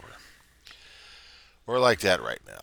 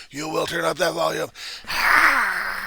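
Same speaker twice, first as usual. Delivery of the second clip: much higher, louder commanding yell; volume turned up; 10 semitones higher, 8 dB louder, slower than usual. Shown as a demonstration. Bully turning up the volume on the Reverend, loud high yell. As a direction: crank it up and bellow. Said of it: "Turn up the volume".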